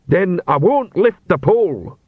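slow, dim, polite troll; a lumbering troll being apologetic. A narrator's reading. Troll voiced.

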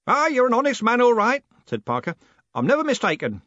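put-on loud, high, hearty old man; blustering old merchant who never lowers his voice; old merchant booming at the young postman. A narrator's reading. Parker praises Moist.

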